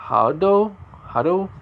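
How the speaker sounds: Hado pitched high as a question; unsure pronunciation. Real, not acted. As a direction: try out "Hado" with a questioning lift. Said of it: "I can't say the name right".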